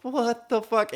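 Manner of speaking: high-pitched incredulous reaction; stunned disbelief. Retold or imitated. Housemates react to the lamp outburst, imitated high disbelief. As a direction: act out baffled shock.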